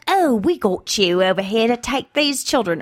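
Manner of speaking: attempted Irish accent; lightly put-on Irish matchmaker. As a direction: have a rough go at Irish. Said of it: "so she does, like, an Irish accent".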